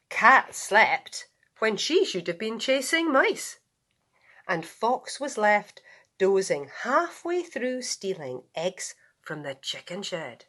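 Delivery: ordinary delivery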